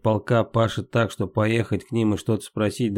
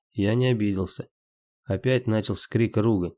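ordinary delivery

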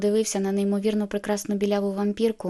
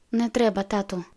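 ordinary delivery

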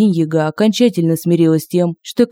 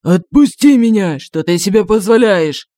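strangled demon; 2 semitones higher, 4 dB louder than usual. A narrator's reading. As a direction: shout while being choked.

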